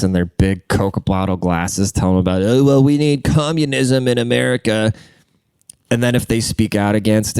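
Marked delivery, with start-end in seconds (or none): 2.4-4.9 higher, prissy lecturing nerd; lightly voiced smug activist; mocking nerd voice. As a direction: sneer at a preachy nerd.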